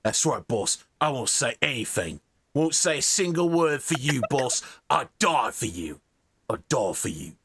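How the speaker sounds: lightly voiced Cockney tough guy; Cockney henchman. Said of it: "It goes".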